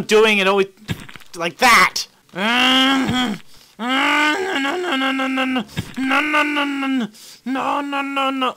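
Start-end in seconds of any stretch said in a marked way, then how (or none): 2.3-8.5 high, rapid string of no's; frantic chanted denial; panicked no's. Real, not acted. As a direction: plead as it all goes wrong. Runner falling, player panicking.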